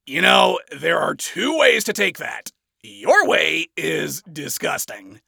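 usual speaking voice

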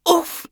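a high little squeak on impact; squeaked oof. Acted. Charlie colliding with Alistair, high squeaked oof. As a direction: yelp as you bump into someone. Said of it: "she squeaked".

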